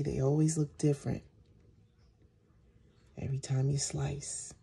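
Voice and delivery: soft, hushed asmr voice; soap cutting asmr. Real, not acted.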